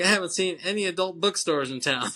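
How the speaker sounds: can't keep a straight face; laughing while quoting. Real, not acted. Laughing through retold line.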